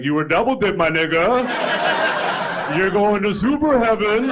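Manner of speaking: lightly voiced God, loud; hint of God. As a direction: announce it loudly as a hyped-up God. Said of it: "God walks up to me, he's like".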